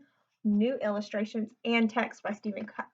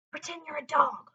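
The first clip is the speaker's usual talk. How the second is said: ordinary delivery, nothing marked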